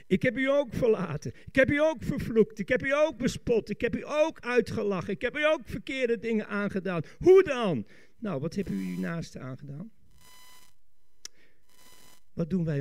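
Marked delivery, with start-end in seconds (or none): 0.1-7.9 insistent preaching, each phrase driven home; emphatic preaching. Acted. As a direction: preach a mounting list of confessions. Preaches an emphatic repeated confession. (nadrukkelijk prekend)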